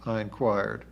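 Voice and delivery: ordinary delivery, nothing marked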